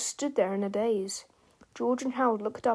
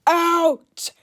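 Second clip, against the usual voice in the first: high, drawn-out furious yell; screaming; 7 semitones higher, 9 dB louder than usual. A narrator's reading. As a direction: scream each word long and loud. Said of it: "Mister Krupp screamed".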